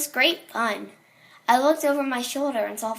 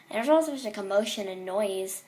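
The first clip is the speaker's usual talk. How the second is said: ordinary delivery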